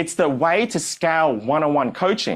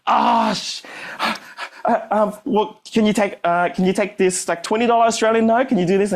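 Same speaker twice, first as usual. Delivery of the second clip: mock fluster; 9 semitones higher than usual. Teller voicing his panicked pleading.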